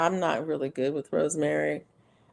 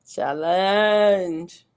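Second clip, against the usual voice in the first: drawn-out challenge; 3 semitones higher, 4 dB louder than usual. Shown as a demonstration. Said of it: "repeating the word".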